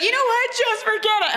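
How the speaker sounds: very high-pitched mock huff; hammed-up upset girlfriend; huffy dismissal. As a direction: throw it off like an offended girlfriend. Acted girlfriend starting a fight.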